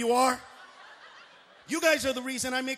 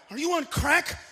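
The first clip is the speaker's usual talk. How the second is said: usual speaking voice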